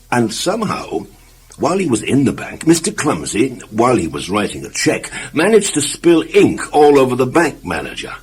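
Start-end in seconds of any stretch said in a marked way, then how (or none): none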